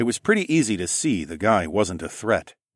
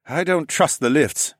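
engineer voice; 7 semitones higher than usual. Acted.

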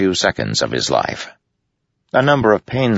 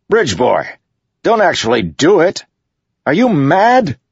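much higher, loud alarmed shouting; 13 semitones higher, 6 dB louder than usual. A narrator's reading. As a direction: yell to stop him, rising in alarm. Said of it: "Adolin's voice called".

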